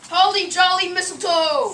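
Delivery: high, loud yell. Acted. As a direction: bellow across the house. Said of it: "yell again".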